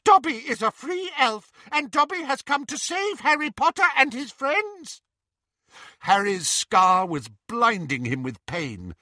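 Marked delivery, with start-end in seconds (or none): 0.0-5.0 high squeaky elf voice; proud elf. A narrator's reading. Dobby to Bellatrix, proud squeaky elf. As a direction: declare it proudly like a tiny elf.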